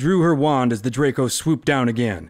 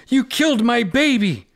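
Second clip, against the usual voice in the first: high, loud accusation; furious shout; 12 semitones higher, 4 dB louder than usual. Acted. Roan beating the witch, furious cry. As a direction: hurl it at the killer.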